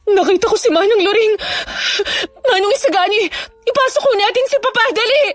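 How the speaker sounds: high, panicky urgency; urgent alarm. Acted. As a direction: rush everyone inside in alarm. Alarmed at seeing vanishing figure. (natatarantang pag-uutos)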